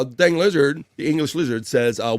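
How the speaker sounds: ordinary talk, nothing marked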